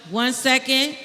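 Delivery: ordinary talk, nothing marked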